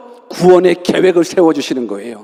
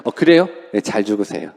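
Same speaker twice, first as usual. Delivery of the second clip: indifferent disciple; 9 semitones lower, 4 dB quieter than usual. Retold or imitated. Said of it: "아니면".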